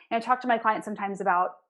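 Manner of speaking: usual speaking voice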